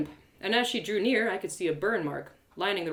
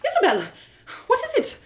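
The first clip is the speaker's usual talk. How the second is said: mild alarm, pitched up; startled, higher concern; 13 semitones higher, 5 dB louder than usual. Acted. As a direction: ask with sudden worry for a friend. Companion alarmed as narrator falters.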